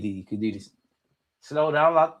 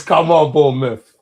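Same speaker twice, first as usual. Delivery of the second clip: cheering; 8 dB louder, slower than usual. Real, not acted. Cheering on Bournemouth.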